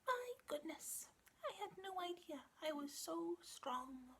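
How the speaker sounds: very high, soft and slow squeaky mouse; a small proud mouse marvelling at himself; tiny mouse voice. Acted. Little mouse amazed at his own strength, high and hushed. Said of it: "the mousey thought to himself".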